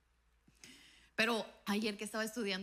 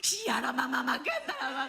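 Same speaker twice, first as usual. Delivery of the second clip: slow, loud, laughing; mock tongues shout; 3 semitones higher, 5 dB louder, slower than usual. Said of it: "Y yo".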